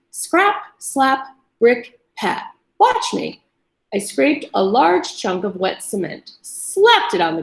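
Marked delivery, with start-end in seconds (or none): 0.1-3.4 higher, sing-song rhyme; grandfather's rhyme. A narrator's reading. Grandfather teaching his work rhyme, sing-song. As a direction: chant it like a proud builder. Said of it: "He would say".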